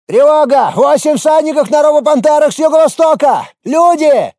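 high, loud alarm call; alarm shout. A narrator's reading. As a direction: yell the warning from the wall. Часовой поднимает тревогу. (крик тревоги)

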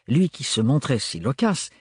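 plain reading voice

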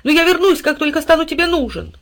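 high, loud dog voice, hurt but loyal. A narrator's reading. Dog vowing to his master he'll return. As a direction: promise it with wounded pride.

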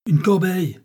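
loud, high angry demand; growled order. A narrator's reading. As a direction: snap out the demand crossly. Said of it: "gronda François".

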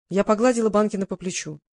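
ordinary delivery

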